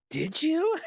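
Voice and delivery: high-pitched mock disbelief, laughter creeping in; retold doubting question. Retold doubting friend in prank.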